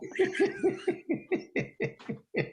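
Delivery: long, high, breathy laugh; helpless run of laughter. Real, not acted. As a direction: laugh hard at your own bit. Laughing at own bit.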